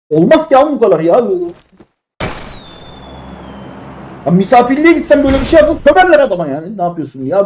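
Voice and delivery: high-pitched indignant rant; öfkeli isyan. Real, not acted. Oyuna isyan edip çıkışıyor. (tiz sesle, giderek yükselen sitem)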